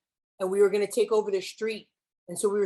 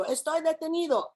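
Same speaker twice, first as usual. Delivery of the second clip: 1 imitating students; 7 semitones higher than usual. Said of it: "And they'd go".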